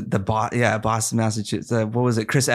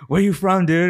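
high surfer dude voice; 8 semitones higher than usual. Retold or imitated. Friend quoted asking.

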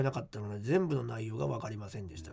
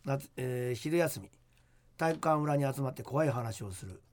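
hushed creepy ghost story; 3 semitones lower than usual. Acted. Reads listener ghost letter.

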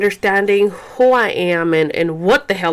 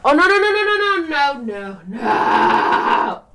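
high, rapid string of no's stretching into a wail; real alarm, high and urgent, easing down at the end; 6 semitones higher, slower than usual. Real, not acted. Mother sees baby about to fall, alarmed.

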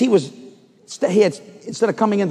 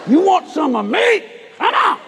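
shouted challenge; 11 semitones higher than usual. Retold or imitated. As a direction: bark the dare at him, half laughing. Retold fight challenge.

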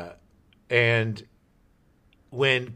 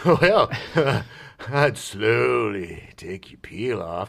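slow, low seductive answer; sleazy bachelor; 3 semitones lower, much slower than usual. Retold or imitated. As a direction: lay on the sleaze.

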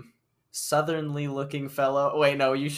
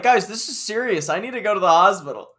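lightly imitated squeaky boy, higher and louder; mock panicked plea; 6 semitones higher, 7 dB louder than usual. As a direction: plead with mock urgency, pitched up. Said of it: "distracted by his squeaky voice".

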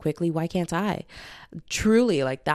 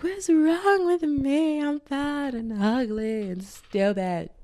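mock self-pity; 9 semitones higher, much slower than usual. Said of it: "just to be like".

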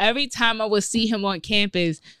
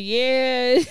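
laughing yeah; 6 semitones higher than usual. Retold or imitated. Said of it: "I'm like".

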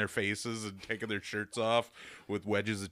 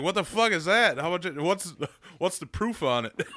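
mock Wisconsin fan; 8 semitones higher, 6 dB louder than usual.

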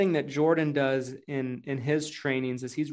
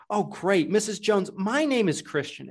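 higher, upbeat introduction; excited sales intro; 6 semitones higher than usual. Shown as a demonstration. Salesman introducing himself to Missus Jones, upbeat. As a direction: introduce yourself with energy. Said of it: "And then I'd lower the tone".

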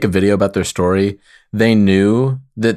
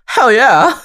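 high, loud and eager; excited; 12 semitones higher, 3 dB louder than usual. Real, not acted. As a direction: blurt it out gleefully. Eager answer to the question.

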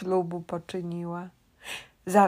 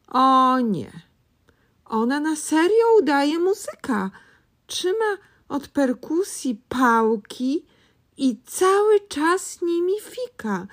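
higher, louder worried telling; alarmed; 7 semitones higher, 5 dB louder than usual. A narrator's reading. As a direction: tell it in rising alarm.

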